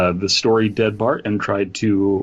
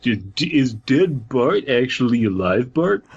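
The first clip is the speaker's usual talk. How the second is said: MatPat impression; 5 semitones higher than usual. Co-host slipping into a MatPat impression, higher and hyped. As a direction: hype it up like a theory video host. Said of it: "I don't know why I'm doing MatPat".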